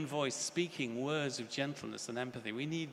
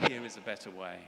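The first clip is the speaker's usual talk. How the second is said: soft offer; 3 semitones lower, 7 dB quieter, faster than usual. Shown as a demonstration. A soft, hushed "Here is a better way.". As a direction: say it softly, gently offering hope. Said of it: "we must also say".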